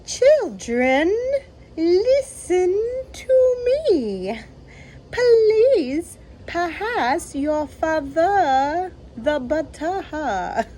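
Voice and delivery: put-on high, unnatural pleading to the children. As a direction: squeak it out in a forced sweet tone. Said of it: "in a high, unnatural voice".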